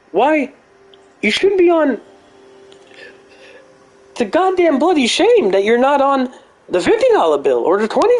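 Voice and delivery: high-pitched mock Jordan Peterson addressing Trump; Jordan Peterson impression. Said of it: "I can do a Jordan Peterson impersonation".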